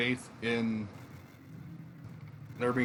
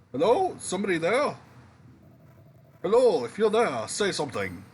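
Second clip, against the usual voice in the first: higher, louder call to someone unseen; 5 semitones higher, 5 dB louder than usual. Acted. Game character calls over the landslide for anyone there, calling out. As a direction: voice a searching call across a barrier.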